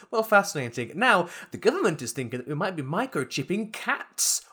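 high, loud breezy anchor; bright TV anchor changing topic; cheery newsreader. Retold or imitated. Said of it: "mocking newsreader".

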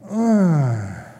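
a heavy, drawn-out sigh; one audible sigh of burden; sigh demo. Shown as a demonstration. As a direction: let out a weary groaning sigh. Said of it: "also translated sigh or sighing".

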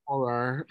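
"Horror" ending in an open "-ar", "hor-ar"; pronounced with A. Shown as a demonstration. Showing a friend their own way, "Horror" as "hor-ar". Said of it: "I pronounce it with an A".

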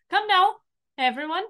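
pompous captain calling his men. Acted. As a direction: sweep everyone along grandly.

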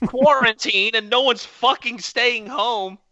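high, loud exasperated rant; worked-up rant. Real, not acted. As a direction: vent about the crowded store. Ranting about crowds in quarantine.

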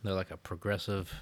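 usual speaking voice

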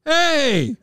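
high bright call; hearty greeting. Retold or imitated. Messier greeting him in the hallway, high call. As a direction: call out like a star greeting a fan. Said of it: "he said".

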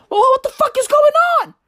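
high fast squeaky confusion; confused crowd. Retold or imitated. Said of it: "everybody was like".

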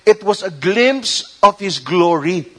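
usual speaking voice

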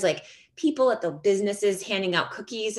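usual speaking voice

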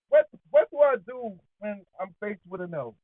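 ordinary talk

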